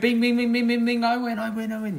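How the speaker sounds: high, rapid bingo chant; ping it out high like a winning machine; mock winning glee. Player acts out three in a row, giddy and high.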